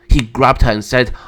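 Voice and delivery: usual speaking voice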